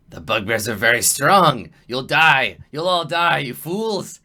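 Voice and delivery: loud, rising doom-laden goblin cry; goblin warning. Acted. Goblin warns the party about bugbears, loud dire warning. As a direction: warn them hard of certain death. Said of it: "he's like".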